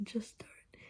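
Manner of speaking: softly whispered just don't. Shown as a demonstration. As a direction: breathe "Just don't" almost silently. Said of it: "because I said".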